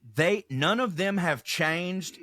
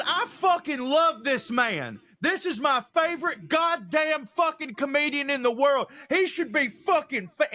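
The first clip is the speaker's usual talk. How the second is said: put-on drunk heckler gushing; 8 semitones higher than usual. Heckler praising the comic to the room, high drunken gush. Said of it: "he goes".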